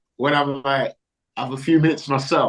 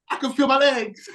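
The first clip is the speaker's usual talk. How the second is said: Eddie Murphy impression; 11 semitones higher than usual. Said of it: "did he do the Eddie Murphy one".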